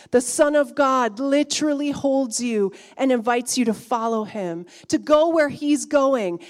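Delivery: loud, rising sermon climax; full-force preacher's cry; preaching at full force. Acted.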